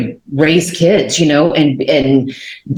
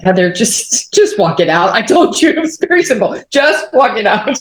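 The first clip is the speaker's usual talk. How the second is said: voiced reply climbing much higher as it repeats; exasperated imitation; 5 semitones higher than usual. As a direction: playfully imitate a simple instruction, pitch rising. Voicing the Lord's simple answer.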